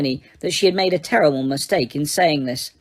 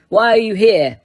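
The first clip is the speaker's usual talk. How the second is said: louder, demanding; bellowed; 3 semitones higher, 5 dB louder than usual. A narrator's reading. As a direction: throw the question at her.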